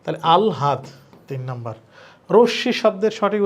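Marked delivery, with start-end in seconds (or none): 0.3-0.8 "আলহাদ" with the ল sounded before the হা. Shown as a demonstration. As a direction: say "আলহাদ" as al-haad. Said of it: "এটার উচ্চারণ হবে আগে ল".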